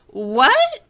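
loud high sputter. A narrator's reading. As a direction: blurt it out shocked. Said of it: "he sputtered".

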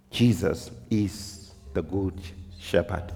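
low, slow and soft, with long pauses; hushed solemn preaching. Acted. Preacher telling congregation who the good shepherd is, hushed solemn preaching. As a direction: let each phrase sink in quietly.

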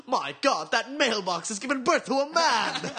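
loud, high, amazed commentator. Retold or imitated. Imitating a TV commentator reporting a mailbox giving birth, astonished commentator. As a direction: stretch it out in mock astonishment. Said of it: "the commentator just goes".

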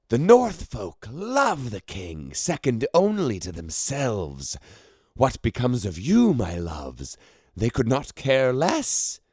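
high, strange haughty voice; scornful sorceress. A narrator's reading. Ceramis lecturing her own contingent, high and scornful. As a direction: sneer down at them from a height.

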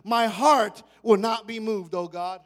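slowed, loud declaration; preached psalm line. Acted. Preacher declaring the psalm verse to the congregation. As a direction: plant each word firmly.

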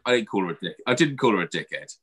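normal talking voice

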